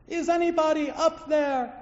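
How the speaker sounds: high, loud plea upward; calling for help. Acted. Man on a cliff calls out for help, loud. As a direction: call up to the sky for help. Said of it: "let out a plea".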